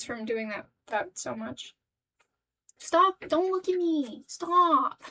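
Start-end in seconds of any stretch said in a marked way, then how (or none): 2.8-5.0 high-pitched whiny protest; higher, play-upset cry to stop; mock distress. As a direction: plead in hammed-up embarrassment. Mock protest at viewers watching.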